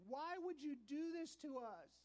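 mother's plea. Retold or imitated. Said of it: "the mother says to me".

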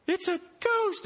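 high, loud ghost shriek; terrified cry. Acted. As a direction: scream it in fright. Disciples see figure on water.

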